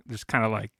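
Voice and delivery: ordinary talk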